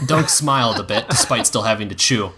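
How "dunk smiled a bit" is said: ordinary delivery, nothing marked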